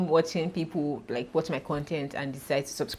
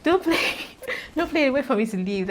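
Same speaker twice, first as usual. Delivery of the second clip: mock panicked plea; 9 semitones higher, 4 dB louder than usual. Pleading not to play the video.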